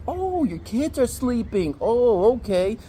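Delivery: high, slow, drawn-out false understanding; mock sarcasm. Man catching her double standard on noise, mock sarcasm. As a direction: stretch it out with exaggerated sarcastic realization.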